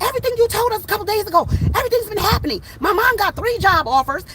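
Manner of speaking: high, rapid, amazed neighbors; imitated excited amazement. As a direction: gush it out like astonished neighbors. Neighbors excitedly report predictions came true.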